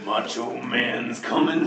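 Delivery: playful wrestling catchphrase delivery; Randy Macho Man. Retold or imitated. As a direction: drawl it like a hammy pro wrestler. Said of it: "He's turning to Randy Macho Man".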